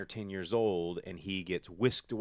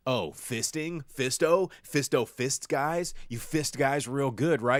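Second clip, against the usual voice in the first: mock teasing; 5 semitones higher, 5 dB louder than usual. Mocking the movie's running joke.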